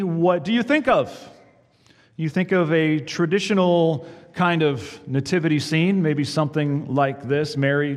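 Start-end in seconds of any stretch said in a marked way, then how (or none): none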